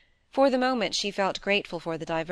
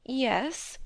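high, tentative little answer; slightly hesitant; 7 semitones higher than usual. A narrator's reading. Wife admits to listening, slightly hesitant. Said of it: "hesitating a little now".

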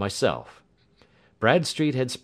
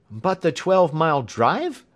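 high, loud startled question; astonished gasp; 10 semitones higher, 7 dB louder than usual. A narrator's reading. Engineer objecting to Holmes's answer, astonished. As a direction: blurt the objection, pitched up. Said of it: "gasped Hatherley".

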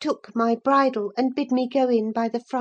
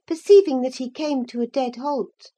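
usual speaking voice

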